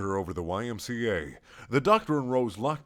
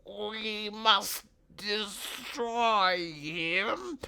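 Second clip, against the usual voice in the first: very slow high robot voice; sleepy robotic drone; sleepy Dalek; 10 semitones higher, much slower than usual. Acted. Tired Dalek answers.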